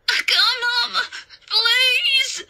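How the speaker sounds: high, loud, drawn-out wail; screaming little-boy plea; terrified pleading child. A narrator's reading. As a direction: beg for mother in sheer panic. Boy begs for his mother.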